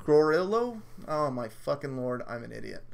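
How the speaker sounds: ordinary talk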